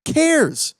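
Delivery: high, loud and scoffing; exasperated outburst. Real, not acted. Ranting to viewers about the Borderlands movie cast, exasperated and scoffing. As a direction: throw the names away in disbelief.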